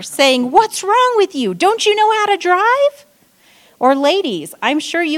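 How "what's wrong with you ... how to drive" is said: much higher and louder road-rage yell; screaming at driver. Retold or imitated. Driver late for an appointment screaming at the car ahead. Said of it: "screaming in my car".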